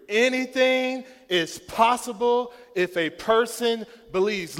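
loud, high shout to lead the crowd; loud call and response. Shown as a demonstration. Pastor leading the congregation's chant, shouting high. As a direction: lead the church in saying it, full voice. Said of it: "you need to say it".

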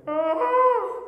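high, loud exaggerated wail; long, hammed-up sob-like "ah"; mock crying wail. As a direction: imitate mom wailing over old videos. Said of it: "and she's crying, going".

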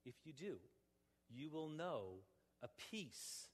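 normal talking voice

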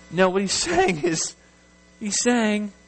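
normal talking voice

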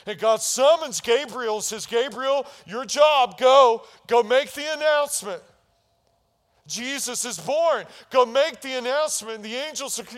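loud, high sermon climax; preaching at full force. Real, not acted. Pastor proclaiming God's command to the church, full-force preaching. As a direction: drive it home to the congregation.

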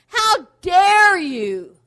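defiant shout. Pretend. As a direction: roar it at the devil. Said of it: "when the devil comes to attack you".

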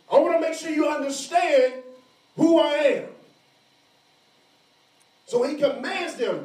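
high, loud pulpit voice, pressing hard; full-force preaching. Acted. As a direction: preach it out to the whole room.

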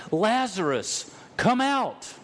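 a loud, high, commanding call into the tomb. Retold or imitated. Jesus calling Lazarus out of the tomb, loud high command. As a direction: call him out of the grave with full force. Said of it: "cried out loudly".

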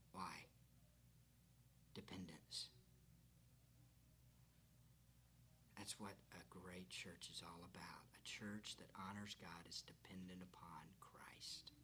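whispered, leaning in close; hushed whisper. Real, not acted. Preacher whispering to the congregation, hushed and intimate. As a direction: drop to a near whisper to draw them in.

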